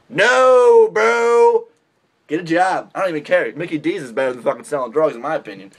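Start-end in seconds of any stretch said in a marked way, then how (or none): 0.1-1.6 louder, much higher "No, bro"; loud dismissive no. Real, not acted. Telling wannabe drug dealers off, loud dismissal. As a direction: shut it down loudly.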